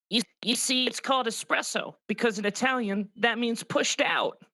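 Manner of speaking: high mocking imitation of a bore; mocking know-it-all voice; mocking voiceover. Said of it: "I'm mocking him".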